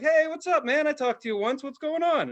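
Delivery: high, breezy phone greeting; casual friend on phone. Retold or imitated. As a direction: answer the call easygoing. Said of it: "they're like".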